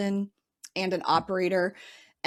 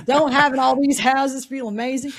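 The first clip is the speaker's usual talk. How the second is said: put-on a light, self-conscious stab at a Southern accent, higher and louder; attempted Southern accent; 5 semitones higher, 6 dB louder than usual. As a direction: imitate a colleague in a half-hearted Southern accent. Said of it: "insert Southern accent here".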